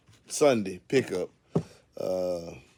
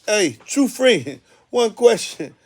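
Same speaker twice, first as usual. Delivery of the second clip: high, loud, laughing chant of the lyrics; hyped lyric recital; 12 semitones higher, 11 dB louder than usual. Real, not acted. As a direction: belt the lines, cracking up with joy.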